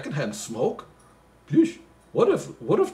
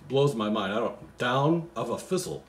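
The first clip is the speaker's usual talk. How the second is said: ordinary talk, nothing marked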